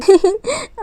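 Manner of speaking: a quick high laugh of delight; a bright little burst of laughter; short laugh. Acted. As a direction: laugh it off happily. Friends delighted in the park.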